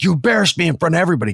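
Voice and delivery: high, fast, heated retelling; angry accusation. Retold or imitated. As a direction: fire off an acted complaint in a quarrel. Acted quarrel with acting coach.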